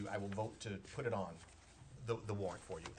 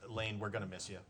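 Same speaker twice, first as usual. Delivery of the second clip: sincere farewell; 6 semitones lower, 6 dB quieter than usual. Real, not acted. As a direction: lower and softer, heartfelt goodbye to a colleague. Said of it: "all jokes aside for once".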